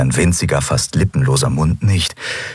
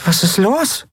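confused Nelen. Acted. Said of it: "fragte Nelen verwirrt".

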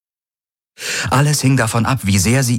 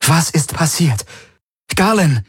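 urgent, higher, demanding an answer; demanding and worried; 9 semitones higher than usual. Acted. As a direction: press him, worried sick. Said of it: "Ihre Stimme war fordernd und besorgt. Sehr, sehr besorgt". (fordernd und sehr besorgt)

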